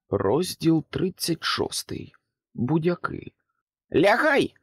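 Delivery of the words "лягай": high, loud order; sharp command shout. A narrator's reading. Pig shouting at Jack to hide. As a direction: bark it to make him drop. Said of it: "вигукнула різдвяна свинка".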